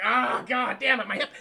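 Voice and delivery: hammed-up aching cry; mock pain. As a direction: imitate an old woman who fell. Pamela knocked down, in pain.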